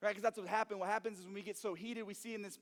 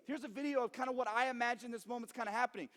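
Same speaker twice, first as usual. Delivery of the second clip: normal talking voice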